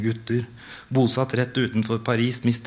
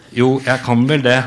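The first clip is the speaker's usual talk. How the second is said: louder reply through a laugh; 3 semitones higher, 8 dB louder than usual. Acted. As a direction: give in with a laugh. Rune resigned reply.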